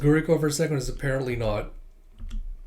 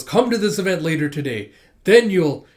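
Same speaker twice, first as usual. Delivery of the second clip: rising louder and louder as she pushes the event; pushing the flyer on her teacher, getting louder; eager invite; 3 semitones higher, 7 dB louder than usual. Acted.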